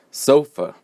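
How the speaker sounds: "sofa" as SO-fa, high loud first syllable; first-syllable stress. Shown as a demonstration. Teacher models word six, strong first-syllable stress on "sofa". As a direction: punch the "so" of "sofa", let the end fall. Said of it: "The first one is stressed".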